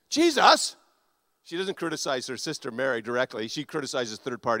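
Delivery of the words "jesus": high, loud exasperated call; critical complaint. Retold or imitated. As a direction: call out in mock indignation to tattle on someone. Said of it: "Remember, she became critical?".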